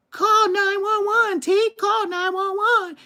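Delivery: high, slow frantic mother's yell; mother screaming at her son in a crisis; mom yelling. Retold or imitated. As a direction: plead in panic as the mother. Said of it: "my mama damn near slapped me".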